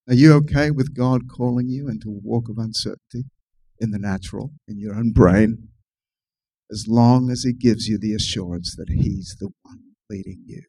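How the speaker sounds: ordinary delivery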